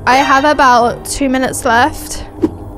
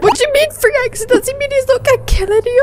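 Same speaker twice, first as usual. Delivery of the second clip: high, fast disbelief; incredulous; 8 semitones higher, faster than usual. Real, not acted. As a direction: blurt it out in disbelief. Confused by game message.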